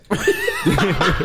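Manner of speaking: high-pitched burst of laughter; laughing. Real, not acted. Bouncer cracks up at having taken the passport, high laugh.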